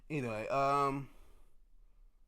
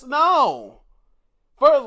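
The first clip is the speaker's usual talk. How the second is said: a sharp, much louder and higher no; indignant shout; 11 semitones higher, 14 dB louder, slower than usual. Real, not acted. Streamer refusing Triple H's booking, indignant shout. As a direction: bark it out in protest. Indignant rant about Logan Paul.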